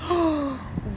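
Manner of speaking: mock damsel-in-distress gasp; big put-on gasp; distraught gasp. Shown as a demonstration. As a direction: gasp as if hearing terrible news. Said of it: "like, distraught, like, damsel in distress".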